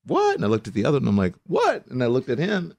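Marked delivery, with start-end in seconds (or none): 0.0-0.4 high-pitched, disbelieving yelp of a question; incredulous. Retold or imitated. Retelling her shock at a friend's news, incredulous. Retold stunned reaction to proposal. 1.5-1.9 high-pitched, disbelieving yelp of a question; incredulous. Retold or imitated. Retelling her shock at a friend's news, incredulous. Retold stunned reaction to proposal.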